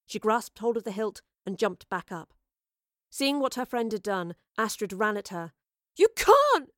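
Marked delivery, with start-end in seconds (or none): 6.0-6.7 high, loud desperate cry; tearful shout. A narrator's reading. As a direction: shout in protest through returning tears. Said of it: "she shouted through returning tears".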